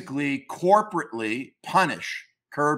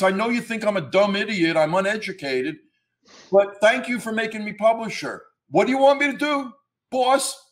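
higher-pitched, eager-to-please underling voice; high, hammed-up dimwit taking orders from the boss; dumb Brooklyn Italian; 5 semitones higher than usual. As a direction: play a dim lackey in a botched Brooklyn Italian accent. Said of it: "I don't know what accent I'm using. It ain't a, an Italian Brooklyn accent".

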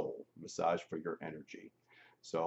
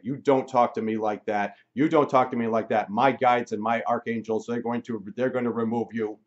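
loud, raised-pitch telling-off; angry scolding; 4 semitones higher, 10 dB louder than usual. Retold or imitated. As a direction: act out a healer angrily ordering a spirit away. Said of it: "she's getting more and more angry".

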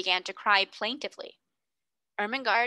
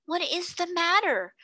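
higher, excited schoolgirls' cry; eager cry; 9 semitones higher than usual. A narrator's reading. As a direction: call out all at once, eager. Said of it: "cried two or three voices".

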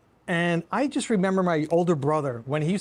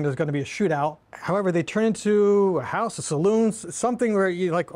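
ordinary talk, nothing marked

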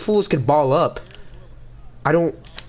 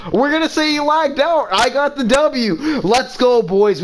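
mock victory cheer; 8 semitones higher than usual. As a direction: pretend you won, hammed-up. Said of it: "I'm just kidding".

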